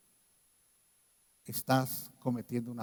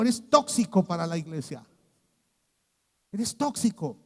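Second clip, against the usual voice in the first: higher, louder pulpit warning; full-force preaching; 6 semitones higher, 8 dB louder than usual. Acted. Preacher warns the congregation about refusing to repent, preaching hard. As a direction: hammer the warning home to the congregation.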